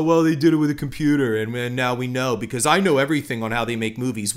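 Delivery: fast, smug mock viewer voice; mocking know-it-all. Podcaster mocking jaded moviegoers, fast and smug. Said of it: "We just go".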